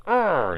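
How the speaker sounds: high, loud mock growl; hammed-up aggressive roar; mock grunt. Damien flips her, grunting.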